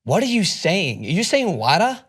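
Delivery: put-on confused questioning in an American accent; American accent, trying to understand; American server. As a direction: imitate a baffled American cashier. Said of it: "She's like".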